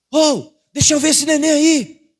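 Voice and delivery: high, loud, slow call across the parking lot; yelling to the client leaving the market. Retold or imitated. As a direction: shout across to the car, delighted. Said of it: "eu gritei".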